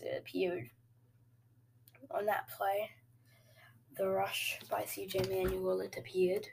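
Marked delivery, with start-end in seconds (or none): none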